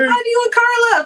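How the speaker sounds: high, eager hail; called-out greeting. Real, not acted. Streamer asks after a viewer and partner, calling out high.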